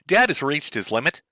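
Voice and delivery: ordinary delivery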